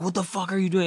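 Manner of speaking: high-pitched mock outrage; alarmed disbelief. Friend reacting to the hole in his drywall, alarmed disbelief.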